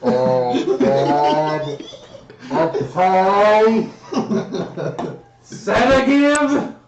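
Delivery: high, loud words through laughter; giggling disbelief; laughing. Real, not acted. GM laughing at the nat twenty.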